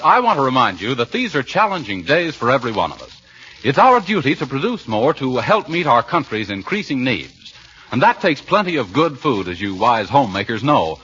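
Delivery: ordinary delivery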